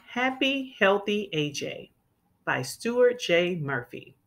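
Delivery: plain reading voice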